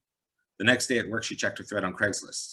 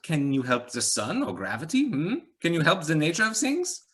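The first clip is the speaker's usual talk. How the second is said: higher rhetorical musing with a hint of accent; light Eastern European accent; 9 semitones higher, 6 dB louder than usual. A narrator's reading. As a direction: lecture her on the nature of things. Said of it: "slight accent, Eastern European".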